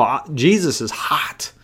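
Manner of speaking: hushed aside, breath only; whisper. Real, not acted. Preacher confiding to congregation, whispered. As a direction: lean in and whisper it. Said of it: "whispered aside".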